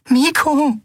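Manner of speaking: "Miko" high and falling, scared; fearful. A narrator's reading. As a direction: repeat "Miko" with fear. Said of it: "wiederholte die Yadi ängstlich".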